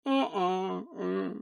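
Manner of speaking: soft whimpering grunt; bigfoot whimper. Acted. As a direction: whimper quietly like a scared beast. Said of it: "Bigfoot turns to the civilian".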